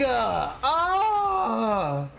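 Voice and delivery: high, drawn-out furious yell; furious scream. Real, not acted. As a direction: scream at the teammate in disbelief. Raging at teammate mid-game.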